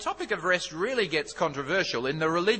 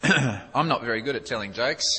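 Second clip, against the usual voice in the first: ordinary talk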